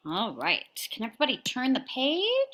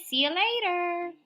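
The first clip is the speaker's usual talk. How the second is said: ordinary talk, nothing marked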